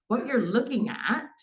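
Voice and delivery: normal talking voice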